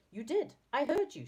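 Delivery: insist, mildly cross; getting cross. A narrator's reading. Saucepan Man insisting he heard right, getting cross. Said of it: "getting cross".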